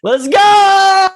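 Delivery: high, loud drawn-out whoop; hype shout. Real, not acted. Host cheers his friend's fishing gear list, hype shout. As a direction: belt it out to fire up the show.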